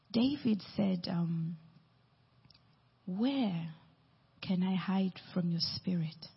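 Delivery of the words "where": normal talking voice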